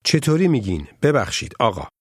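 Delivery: normal talking voice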